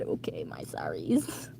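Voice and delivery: soft breathy whisper; whispered apology. Real, not acted. Apologizing after a slip.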